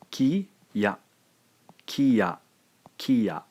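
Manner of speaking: "ki ya" said as two clear syllables, ki then ya; two separate syllables. Shown as a demonstration. Teacher showing learners two syllables, clearly separated. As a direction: split "ki ya" into two even beats. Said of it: "pronounced together as a word".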